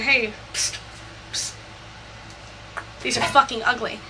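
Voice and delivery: hushed, secretive recruiting; softly, so Mom can't hear; conspiratorial aside. Retold or imitated. Secretly recruiting stepfather behind mother.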